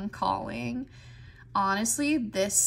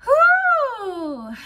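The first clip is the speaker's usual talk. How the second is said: loud whew; 13 semitones higher, 11 dB louder than usual. Real, not acted. Big exhale before starting.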